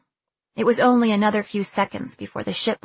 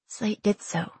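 ordinary delivery

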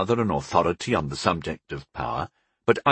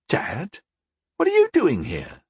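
high-pitched startled question; surprised, startled; 9 semitones higher than usual. A narrator's reading. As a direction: caught off guard, voice jumps up. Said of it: "surprised and startled".

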